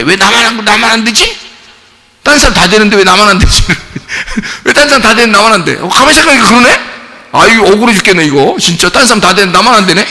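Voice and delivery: high-pitched, put-on 'why only me' grumbling; acted aggrieved complaint. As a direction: play someone stewing over unfair treatment. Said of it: "엄청 억울해집니다".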